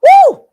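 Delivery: high, loud whoop of disbelief; excited whoop. Real, not acted. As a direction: let out a big shocked woo. Reactor erupts at the clip.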